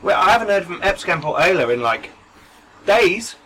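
high, frantic, scared out of his mind; panicked rant. Acted. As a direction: spill it out in rising panic. Panicked NPC ranting over comms.

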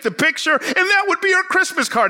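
mildly imitating an upset churchgoer; peeved older lady. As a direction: lightly voice her grumbling complaint. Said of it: "she's ticked off, and she's peeved".

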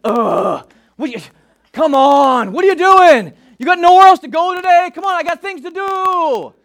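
hammed-up road rage, shouting; impatient driver rant. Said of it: "I'm just constantly like".

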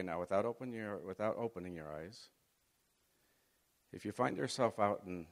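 normal talking voice

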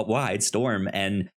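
normal talking voice

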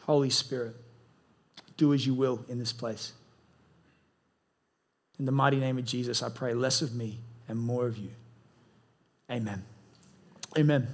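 low, reverent pastoral prayer; leading prayer. Real, not acted. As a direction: pray aloud for the room, low and unhurried. Leads congregation in prayer.

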